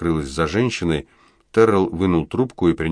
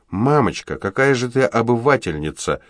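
put-on girl's retort; 6 semitones higher than usual. A narrator's reading. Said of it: "выдала девочка".

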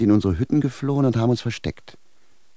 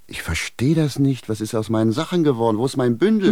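rising panic; 5 semitones higher, 3 dB louder than usual. Acted. Yang to the sage about his lost bundle, bewildered then panicking. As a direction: panic growing line by line.

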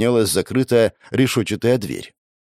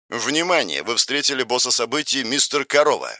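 system announcement; 3 semitones higher than usual. Acted. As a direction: read it like a game system message.

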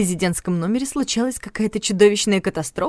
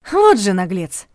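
indignant exclamation; 7 dB louder, slower than usual. Acted. Heroine outraged by boss.

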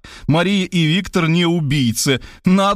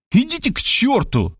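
high mock yell; 10 semitones higher than usual. Writer yelling at the detective to go away. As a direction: shout it off in exasperation. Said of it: "завопил я".

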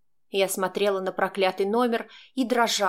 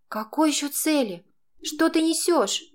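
much higher, agitated questions; indignant bewilderment; 7 semitones higher than usual. A narrator's reading. Girl confused by Max's talk of goals, indignant bewilderment. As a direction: demand sense from him, high-pitched.

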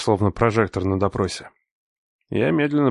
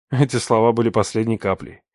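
usual speaking voice

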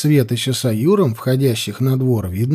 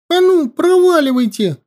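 put-on high, loud boy's yell; boy shouting; 4 dB louder than usual. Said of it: "крикнул он".

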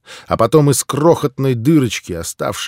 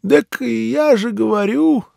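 put-on old man voice; 12 semitones higher, 3 dB louder, slower than usual. Old man speaking in story.

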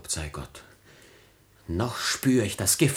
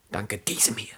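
faint whispered accusation; dying whisper; weak whisper; 3 dB quieter than usual. Acted. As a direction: whisper it with your last strength. Dying Ferdinand accuses his father.

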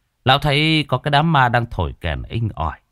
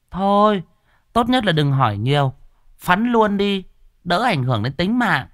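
higher, sharp female voice; curt village woman; 10 semitones higher than usual. A narrator's reading. Gossipy woman brushes off the sorcerer, higher curt female voice.

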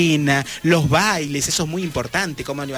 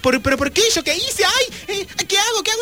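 mock panic. Panicked man locked in cabin.

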